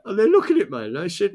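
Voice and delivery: usual speaking voice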